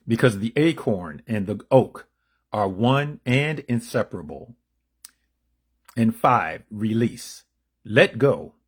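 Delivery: read-aloud declamation. Acted. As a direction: proclaim each step with measured emphasis. Reads out steps from text.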